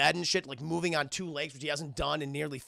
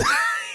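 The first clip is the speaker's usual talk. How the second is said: laugh; 6 dB louder than usual. Real, not acted. Laughing at own bit.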